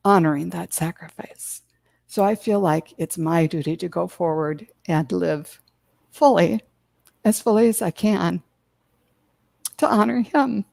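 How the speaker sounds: fighting back tears as she speaks; teary. Real, not acted. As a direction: voice breaking with tears, then whispered. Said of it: "I didn't mean to get all teary".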